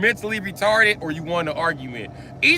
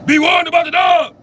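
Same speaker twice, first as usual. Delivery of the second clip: shouted warning; 9 semitones higher, 7 dB louder than usual. Acted.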